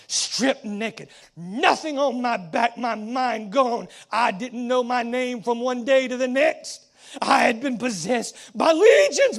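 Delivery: loud, rising preacher's cry; fiery preaching. Acted. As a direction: preach it hard, voice climbing. Preaching at full force as possessed man.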